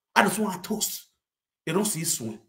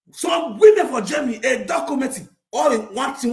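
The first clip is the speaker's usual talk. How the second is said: loud exclaim; 5 semitones higher, 6 dB louder than usual. Acted.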